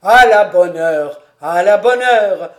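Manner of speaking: loud, jubilant cheer; enthusiastic shouting. A narrator's reading. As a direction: shout it out with gusto. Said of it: "et se mit à crier". (crier avec enthousiasme)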